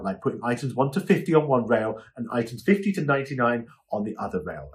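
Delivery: ordinary talk, nothing marked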